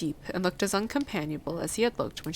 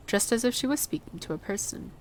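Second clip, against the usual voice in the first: plain reading voice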